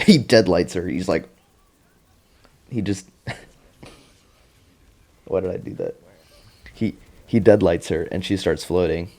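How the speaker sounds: ordinary talk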